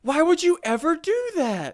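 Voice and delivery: high-pitched, exasperated demand; indignant scolding. Real, not acted. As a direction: scold your friend in disbelief. Scolding partner for wasting cobble.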